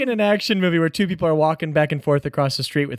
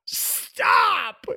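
high, wailing, tearful crier; crying town crier. Acted. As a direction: blubber like a bullied crier. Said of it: "stop crying, dude".

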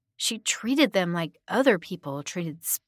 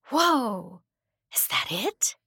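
put-on higher, awestruck girl voice; amazed, pitched-up exclamation; gasped; 5 semitones higher, slower than usual. A narrator's reading. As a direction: gasp it in wonder. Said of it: "she gasped as soon as".

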